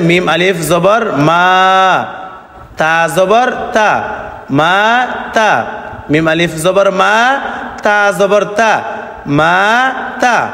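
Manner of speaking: মা held long, তা short; measured recitation with a drawn-out মা; elongation drill. Shown as a demonstration. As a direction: spell it out deliberately, stretching মা before a brief তা. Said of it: "এক আলিফটা নিয়া পড়িতে হয়".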